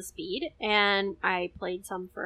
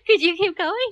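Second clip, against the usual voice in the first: real laugh through the request; high, loud, amused plea; 8 semitones higher, 8 dB louder than usual. Real, not acted. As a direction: ask while cracking up. Laughing at the speed-reading.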